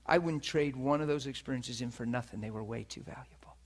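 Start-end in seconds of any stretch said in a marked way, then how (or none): 2.4-3.6 whispered aside. Real, not acted. Heartfelt aside.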